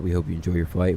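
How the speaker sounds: ordinary talk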